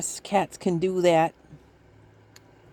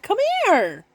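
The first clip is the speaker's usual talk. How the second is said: calling cat; 14 semitones higher, 3 dB louder than usual. Retold or imitated. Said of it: "I'm like".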